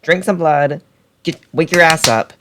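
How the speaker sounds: pissy. Retold or imitated. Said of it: "pissily like".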